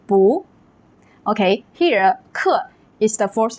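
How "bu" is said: bu with a rising second tone. Shown as a demonstration. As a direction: say "bu" climbing upward like a question. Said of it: "it will change to a second tone".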